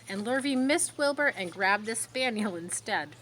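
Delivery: plain reading voice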